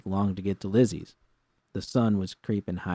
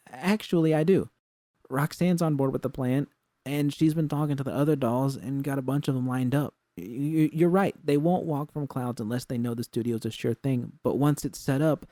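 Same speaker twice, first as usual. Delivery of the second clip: Jude's higher, insistent voice; defensive pitch; 6 semitones higher, 4 dB louder than usual. A narrator's reading. Jude defends her plan to doubtful V, insistent. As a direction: push back with eager certainty.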